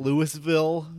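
"Louisville" said LOO-iss-vil; "Louisville" read as spelt, Lewis plus ville. Shown as a demonstration. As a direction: sound the s in "Louisville". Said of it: "everyone outside of Kentucky calls it Louisville".

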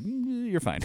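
high, hesitant "mm", then let it go; mock reluctant okay; dismissive mock approval. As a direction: quietly wave it off in a high voice. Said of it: "they were just like".

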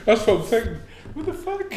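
high, incredulous words through laughter; real helpless amusement in the voice; laughing. Real, not acted. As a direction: crack up while protesting. Cracking up at plot absurdity.

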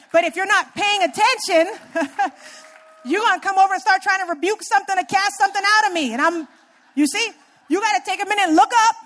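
fired-up laughing exhortation; rising to a joyful preaching peak; excited preaching. Real, not acted.

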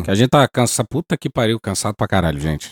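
worn-out muttered swearing; tired cursing under breath. Real, not acted. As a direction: sigh the curses out, exhausted. Host exhausted, swearing at show's end.

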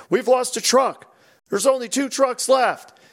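mock alarm over lost trucks; panicky call-out guy. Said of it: "then the guy, you know".

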